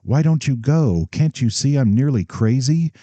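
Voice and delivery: nearly out of control with panic; almost hysterical. Acted. Bride on her last night, almost hysterical at father. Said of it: "The girl was almost hysterical".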